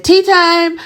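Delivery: mock school bell call; quoting the judge. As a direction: call out tea time like a teacher. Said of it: "when the judge said".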